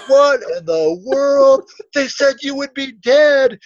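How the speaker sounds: high, loud mock astonishment; shocked disbelief. Witness watching a man collapse at the airport, stunned. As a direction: act out a stunned bystander's outcry.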